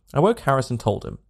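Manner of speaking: ordinary delivery, nothing marked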